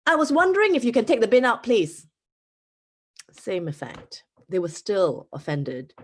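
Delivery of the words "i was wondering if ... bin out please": Singaporean English, higher, louder and fast; attempted polite request. Chia tries a politer way to ask, in a Singaporean accent. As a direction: try hard to sound polite in a Singaporean accent. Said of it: "a more polite way to say this".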